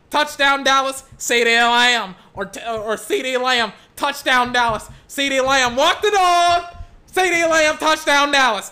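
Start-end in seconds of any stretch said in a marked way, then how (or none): 0.1-2.1 louder, slow drawn-out announcer call; slow booming radio call of a touchdown. Shown as a demonstration. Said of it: "practicing a lot of different ways to say". 3.0-8.7 fast, loud, much higher announcer call building to a peak; frantic radio call peaking at walk the dog; radio touchdown call. Shown as a demonstration. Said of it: "practicing a lot of different ways to say".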